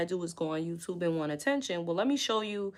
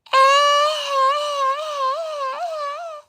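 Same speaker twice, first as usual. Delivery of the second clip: loud, very high shriek of laughter; 10 dB louder than usual. Real, not acted. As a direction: let out a wild shrieking laugh.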